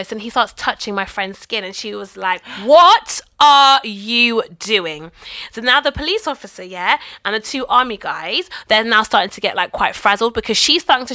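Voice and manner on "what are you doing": retold angry shout. Friend confronts officer touching skin.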